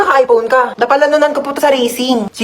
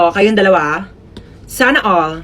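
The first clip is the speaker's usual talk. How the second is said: ordinary delivery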